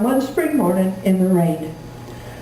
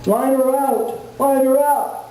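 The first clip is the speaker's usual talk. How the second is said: directing from the back; 3 semitones higher, slower than usual. Retold or imitated. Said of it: "he directed from the back".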